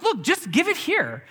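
impatient mom. Retold or imitated. Mom losing patience with the fussing child, high and insistent. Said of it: "my mom's like".

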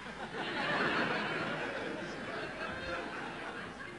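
breathy high laugh at his own joke; airy laugh. Real, not acted. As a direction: let a quiet airy laugh slip out.

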